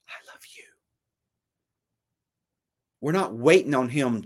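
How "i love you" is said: true whisper, barely audible; God's whisper. Acted. Preacher gives God's answer to the listener, whispered. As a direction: whisper it, all breath, very softly. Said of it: "he has said it".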